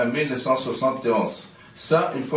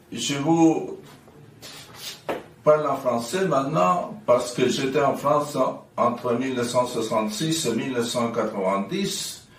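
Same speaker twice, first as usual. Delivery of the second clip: Spanish-accented French; French spoken with a Chilean Spanish accent; foreign accent in french; 3 semitones lower than usual. Real, not acted. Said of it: "je m'excuse si mon français n'est pas très bon".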